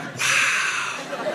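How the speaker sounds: hushed, whispered awe; imitated cop's whispered amazement; almost impressed. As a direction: let it out on a soft breath. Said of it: "he was almost impressed".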